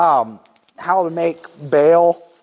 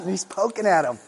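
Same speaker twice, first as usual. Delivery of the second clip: normal talking voice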